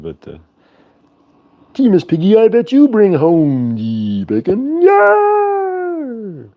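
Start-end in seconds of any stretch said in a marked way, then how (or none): 1.7-6.5 high, slow Muppet character voice. Retold or imitated. Flirting with Miss Piggy in a high Muppet voice. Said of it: "I'm not no Jim Henson".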